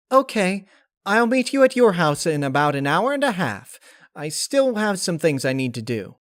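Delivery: high, loud bouncy pony voice; chipper Pinkie. Acted. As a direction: bounce with energy. Pinkie making plans.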